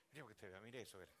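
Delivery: ordinary talk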